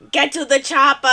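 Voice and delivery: mock Austrian accent, warming up; catchphrase in a put-on Austrian accent; Arnold impression. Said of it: "I wanna hear your Arnold".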